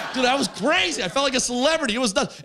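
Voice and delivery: high, breakneck-thrilled bragging; giddy excitement. Real, not acted. Telling crowd about dance floor fame, giddily excited. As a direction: gush about it with the pitch way up. Recalling thrill of feeling famous.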